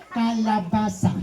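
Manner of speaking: kalabasa said slowly, clear s in basa; slow teaching kalabasa, stressing the s; correct pronunciation. Shown as a demonstration. Interviewer corrects boy, slow careful kalabasa. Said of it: "You say".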